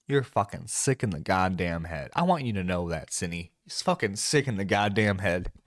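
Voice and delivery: low-pitched, contained disgust; grim, low-register scolding; disgusted condemnation. Real, not acted. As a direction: drop the voice low and condemn them. Disgusted address to apologizer.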